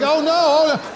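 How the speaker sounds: high-pitched comic "oh no"; hammed-up panicked lament; mock dismay. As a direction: wail helplessly as disaster happens. Retelling dismay at dropped roll.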